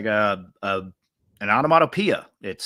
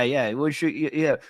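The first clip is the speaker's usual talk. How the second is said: usual speaking voice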